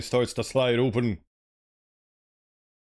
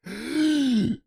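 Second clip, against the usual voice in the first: high startled intake of breath; sharp gasp; 12 semitones higher than usual. Acted. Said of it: "Olivia takes a sharp breath".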